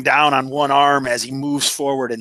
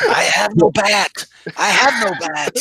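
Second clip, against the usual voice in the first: louder, higher comic yell; raised, comic character voice; mock call-out; 7 semitones higher, 3 dB louder than usual. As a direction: call it out in hammed-up distress. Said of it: "he's like".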